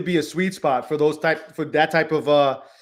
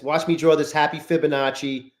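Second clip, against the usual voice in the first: normal talking voice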